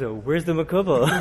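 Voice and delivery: laughing surprise. Retold or imitated. Surprised at the party.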